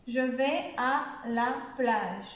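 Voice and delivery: slow, spaced-out teacher's model; slow example sentence. Shown as a demonstration. French teacher shows beginners an example, slowly and evenly.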